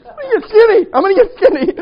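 high, loud laughing lament; mock wail. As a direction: wail your diet vow in despair. Self-mocking retold vow.

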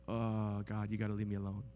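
low, soft, sheepish; ashamed mumble. Acted. The ashamed sinner asks God to leave, soft and sheepish. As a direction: shrink away in shame.

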